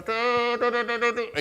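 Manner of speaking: high, loud run of "tu" toots; imitated megaphone noise. Shown as a demonstration. Preacher showing what a megaphone is, loud imitated toots. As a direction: toot like a bullhorn being tested. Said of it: "Un megáfono es esa, esa cosita que uno pone acá".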